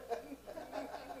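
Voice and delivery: a high, airy giggle at himself; sheepish high laugh; high breathy laugh. Real, not acted. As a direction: laugh at your own nervousness. Laughs at his own fear.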